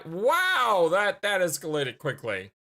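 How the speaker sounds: loud startled wow; surprised. Real, not acted. Shocked at threat.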